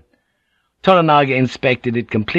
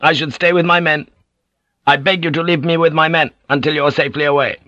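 urgent, higher pleading voice; pleading retainer; 7 semitones higher, faster than usual. Acted. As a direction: beg respectfully to stay. Narrator voices a pleading samurai.

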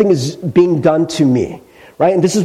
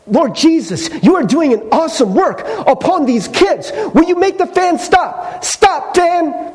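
much higher, pleading and building to a command; comic exasperated prayer at the fan; desperate mock prayer; 11 semitones higher than usual. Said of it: "He's like".

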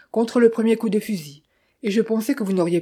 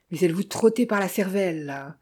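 plain reading voice

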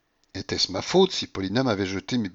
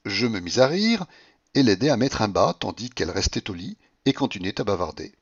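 ordinary delivery, nothing marked

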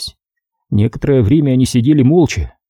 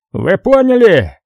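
slow, higher, louder demand; bellowed question; 9 semitones higher, 3 dB louder, slower than usual. A narrator's reading. Boss yelling at his men, a restrained bellow. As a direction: lean on it like a furious boss, understated. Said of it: "заорал он".

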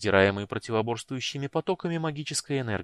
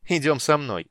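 eager command; 5 semitones higher, 7 dB louder than usual. Acted.

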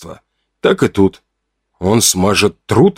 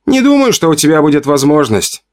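tense, higher-pitched hissed dismissal; hissed retort; 7 semitones higher than usual. A narrator's reading. Man snubbing the narrator's offer, hissed retort. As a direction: hiss the doubt at him, pitched up. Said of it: "прошипел он".

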